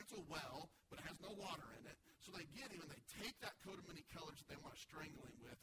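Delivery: ordinary talk, nothing marked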